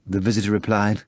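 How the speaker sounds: usual speaking voice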